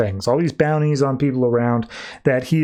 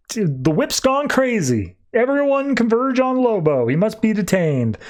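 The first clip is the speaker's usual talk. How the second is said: higher, urgent call to the crew, lightly acted; rally the gang, pitched up; mock urgent order; 7 semitones higher than usual. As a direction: boss rallying her gang against Lobo, urgent and higher. Boss calls gang to converge.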